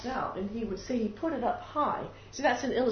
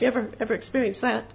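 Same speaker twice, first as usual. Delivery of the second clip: ordinary delivery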